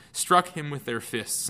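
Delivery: ordinary talk, nothing marked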